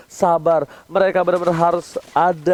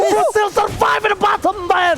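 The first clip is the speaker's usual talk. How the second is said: drawn-out hype call, higher; hype shout; 10 semitones higher, slower than usual. Real, not acted. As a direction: shout the big play slowly. Caster hype on big play.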